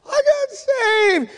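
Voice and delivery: high, slow wail of joy; imitated tearful cry. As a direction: act out a son crying out his news, stretched. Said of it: "And he starts crying".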